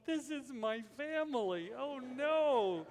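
high-pitched wail of embarrassment, acted; mock dismay. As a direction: imitate a mortified teenager lamenting. Granddaughter mortified before boyfriend.

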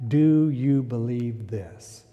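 very slow, quiet, searching question; slow soft question. Shown as a demonstration. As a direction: ask it gently and let it hang. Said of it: "That is the greatest question".

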